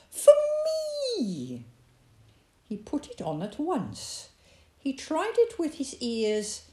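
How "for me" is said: delighted rabbit. A narrator's reading.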